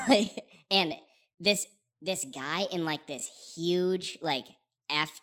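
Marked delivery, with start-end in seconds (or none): none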